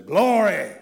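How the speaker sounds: loud ringing shout of Glory; old-time preacher's cry. Retold or imitated. Recalling his boyhood preacher, a loud ringing Glory. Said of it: "that preacher used to always say".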